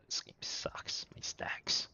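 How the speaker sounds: whispered complaint to yourself; barely voiced, slow grumble under the breath; muttered grumble. Real, not acted. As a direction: whisper it as a private gripe. Muttered complaint to self while playing.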